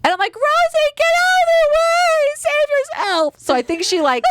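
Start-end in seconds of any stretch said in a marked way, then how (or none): 0.3-3.3 much higher frantic call; mock panicked warning. Warning Rosie during the crash, high frantic call. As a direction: cry out a dramatic warning.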